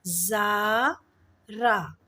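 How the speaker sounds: ज़ारा split into zaa and raa, very slow; drawn-out syllables. Shown as a demonstration. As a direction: stretch the 'aa' vowels in ज़ारा. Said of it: "इन सब में अ का sound आ रहा है".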